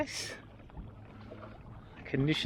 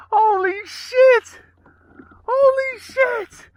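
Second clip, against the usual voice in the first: slow, drawn-out, much higher and louder; stunned yell; 11 dB louder, much slower than usual. Real, not acted. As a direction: stretch each word out in shock. Disbelief at the catch.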